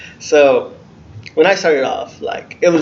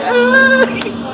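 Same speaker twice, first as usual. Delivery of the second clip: high startled ah; 8 dB louder than usual. Retold or imitated. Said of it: "I was like".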